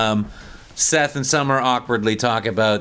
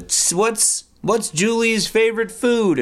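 hammed-up game host voice; 12 semitones higher, slower than usual. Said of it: "And she's like".

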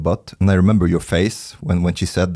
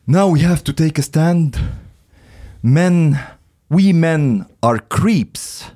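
higher, slow solemn declaration; a lightly voiced mock-earnest proclamation; earnest American man; 6 semitones higher, slower than usual. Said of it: "started the discussion by saying".

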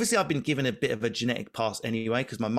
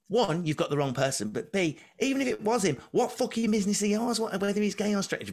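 angry rant; 9 semitones higher than usual. Retold or imitated. Lindsay blowing up at girl.

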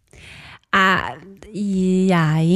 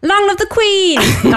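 high, louder, slow hammed-up call; mock rallying cry; 11 semitones higher, 4 dB louder, slower than usual. Guest hamming a shout of long live the Queen. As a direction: shout it like a toast to royalty. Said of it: "Kannst du es mal rufen?".

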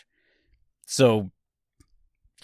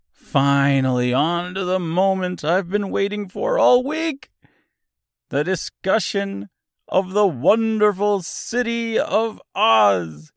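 excited buildup; 9 semitones higher than usual. Real, not acted. Host introducing the long-awaited chapter discussion, excited and high-pitched. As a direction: draw it out with soaring anticipation.

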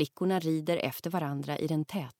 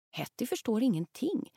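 ordinary delivery

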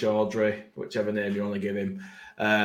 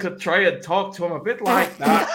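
higher Norfolk attempt slipping into Irish; bad Norfolk accent; 7 semitones higher, 4 dB louder than usual. Shown as a demonstration. One more go for his mates, botched Norfolk accent. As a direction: put on a badly faked Norfolk accent. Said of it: "Do it one more time".

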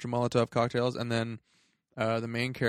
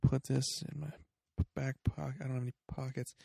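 quiet musing aside; 2 semitones higher, 7 dB quieter, faster than usual. Retold or imitated. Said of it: "looks around like".

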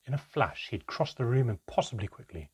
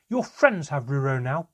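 loud, high-pitched angry yell; child's enraged outburst at a parent; furious shouting; 5 semitones higher, 8 dB louder than usual. Acted. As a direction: shout it like a blow landing. Child attacking father, shouting.

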